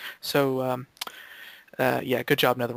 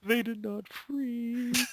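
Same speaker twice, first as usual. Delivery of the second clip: put-on old man voice; 8 semitones higher, 6 dB quieter, much slower than usual. Repeats mock old-timer line.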